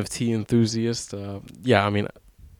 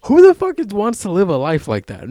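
loud, high-pitched disbelief; incredulous outburst; 9 semitones higher, 6 dB louder than usual. Retold or imitated. Podcaster reacting to sixteen-hour workday advice, incredulous. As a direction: burst out higher and louder, appalled at the idea.

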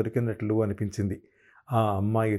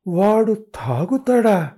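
high, loud, slow disbelief; mother-in-law stunned to hear her son drinks; 9 semitones higher, 7 dB louder, much slower than usual. Acted. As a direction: gasp it out in shock. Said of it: "ఆవిడ తెల్లబోతూ".

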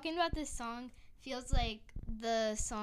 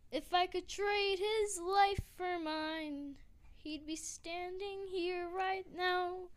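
emotional quote; 7 semitones higher, slower than usual. Retold or imitated. Quoting grieving Hamilton lyric.

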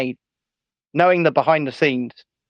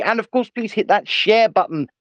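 usual speaking voice

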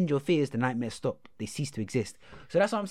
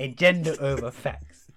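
slogan delivery; 5 dB louder, slower than usual. Acted. Echoing friend's slogan.

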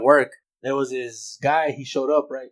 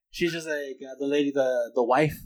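usual speaking voice

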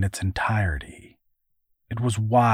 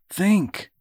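sharply higher, more urgent order to himself; insistent self-command; 10 semitones higher than usual. A narrator's reading. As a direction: push yourself hard to focus. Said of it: "he commanded himself".

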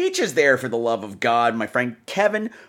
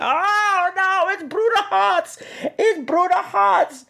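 high, drawn-out mock wail; whiny, high-pitched pretend crying; mock horror; much slower than usual. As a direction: wail it slowly in comic dread. Mock horror at old nightmare teacher.

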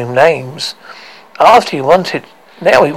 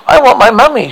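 put-on high, louder childlike bleat; pitched-up, pleading wail; bleating plea; 8 semitones higher, 5 dB louder, faster than usual. A narrator's reading. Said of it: "someone bleated".

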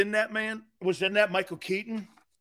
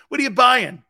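louder, higher mocking echo of the question; mock Ray Kroc sneer; 5 semitones higher, 7 dB louder than usual. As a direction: throw their question back at them, mocking. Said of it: "He looks at both of them and goes".

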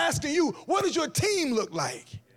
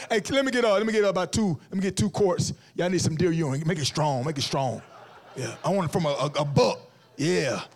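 lower, gruff country buyer ordering deer pee; hunter at the counter; 7 semitones lower than usual. Pretend. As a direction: play a lower-voiced good-old-boy at the shop counter. Buying deer urine at store.

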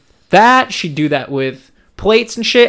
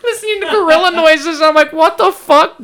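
laughing; 13 semitones higher, 4 dB louder than usual. Retold or imitated. Laughing recounting confusion.

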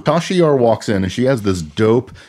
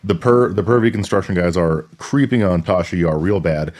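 ordinary talk, nothing marked

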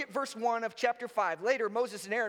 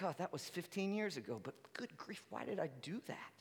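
much softer, muttered regret; cringing self-talk; 4 semitones lower, 13 dB quieter than usual. Retold or imitated. Listener recalling an embarrassing choice, soft mutter. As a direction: wince at an old memory. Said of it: "And you're like".

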